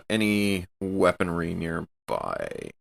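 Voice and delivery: ordinary talk, nothing marked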